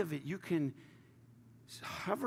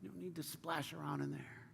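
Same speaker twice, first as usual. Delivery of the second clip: much softer, half-whispered plea; soft pleading; 13 dB quieter than usual. Retold or imitated. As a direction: gently plead through tears. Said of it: "Jesus is weeping. It's like".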